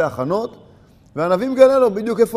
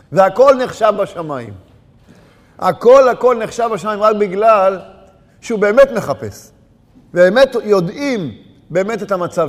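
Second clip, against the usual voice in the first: fiery preaching; 3 semitones higher, 5 dB louder than usual. Acted. Preacher urging congregation on their faults, loud preaching. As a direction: hammer each point to the congregation. Preacher builds to full force.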